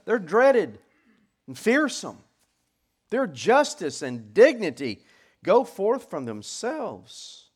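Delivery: loud, high, measured proclamation; forceful pulpit reading; declaimed scripture. Acted. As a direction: ring out each fearsome trait.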